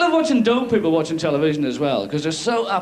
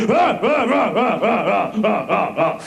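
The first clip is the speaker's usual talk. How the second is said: dog barking; slower than usual. Retold or imitated.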